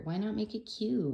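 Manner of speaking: ordinary talk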